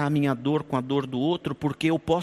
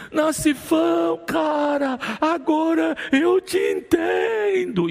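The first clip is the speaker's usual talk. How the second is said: high, slow, sobbing call; crying out; 10 semitones higher, much slower than usual. Retold or imitated. Weeping brother calling to the pastor, high slow crying. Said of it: "começou a chorar e gritou".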